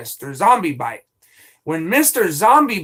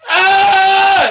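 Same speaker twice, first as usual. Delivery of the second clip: long, high, loud scream; real held yell of exasperation; frustrated scream; 14 semitones higher, 11 dB louder than usual. Real, not acted. Streamer fed up with being falsely accused, frustrated scream.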